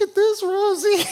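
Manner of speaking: put-on very high, loud childish taunt; teasing kids. Kids teasing the dog with food, very high taunt. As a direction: tempt the dog like a teasing kid. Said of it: "the kids would be going".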